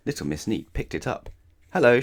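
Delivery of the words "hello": bright phone greeting. Acted.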